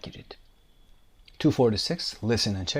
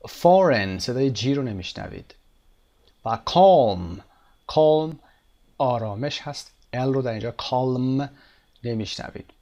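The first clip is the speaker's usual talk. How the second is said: normal talking voice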